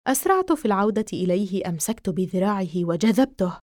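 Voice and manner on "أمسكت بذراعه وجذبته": usual speaking voice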